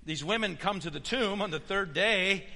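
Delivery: ordinary talk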